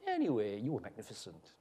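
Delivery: soft, low, dropping away; subdued, almost under the breath; offhand praise. Acted. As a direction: toss the compliment off quietly. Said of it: "Why did you say it like that?".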